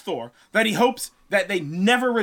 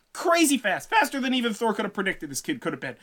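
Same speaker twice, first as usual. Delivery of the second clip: ordinary talk, nothing marked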